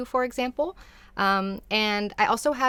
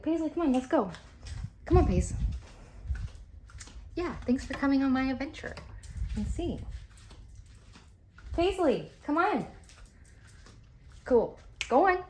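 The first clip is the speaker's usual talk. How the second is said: calling a dog; 6 semitones higher, 4 dB quieter than usual. Real, not acted.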